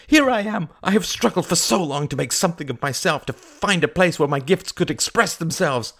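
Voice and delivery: high, agitated outburst; heated, high-pitched complaint; flash of anger. Acted. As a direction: vent indignantly about the struggle. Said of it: "cried Mary with a flash of anger".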